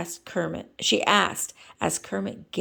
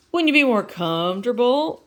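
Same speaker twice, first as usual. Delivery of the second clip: Kermit voice; 6 semitones higher, 6 dB louder than usual. Acted.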